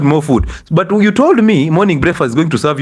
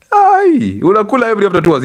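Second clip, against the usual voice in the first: mock astonishment; 7 semitones higher than usual. Speaker scolding the audience for eating every two hours, in mock astonishment. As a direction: exclaim high-pitched in mock disbelief.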